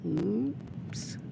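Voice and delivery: hushed, sheepish little oops; whisper-quiet uh-oh to herself; a small guilty oops under the breath; soft oops. Acted. Child oops after breaking lamp.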